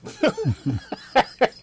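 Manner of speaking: high chuckle; light, high-pitched laughter; laughing. Real, not acted. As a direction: burst into an amused laugh. Amused by kitten in box.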